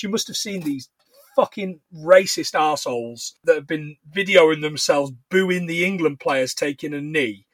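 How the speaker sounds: ordinary talk, nothing marked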